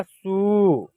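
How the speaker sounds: सु held out with a full, long vowel; full-sounding, sustained सु. Shown as a demonstration. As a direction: stretch the vowel in सु. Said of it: "पूरा sound में होगा".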